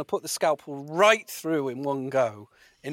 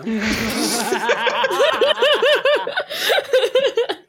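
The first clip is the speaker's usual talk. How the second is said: high laugh; 7 dB louder than usual. Real, not acted. Big laugh.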